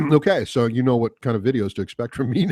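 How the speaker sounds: normal talking voice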